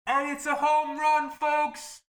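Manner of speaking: high, loud home run call; sports announcer. Pretend. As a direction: announce it like a baseball play-by-play. Mock home run call on sneeze.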